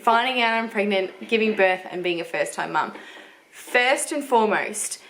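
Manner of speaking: usual speaking voice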